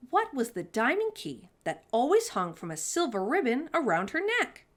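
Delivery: usual speaking voice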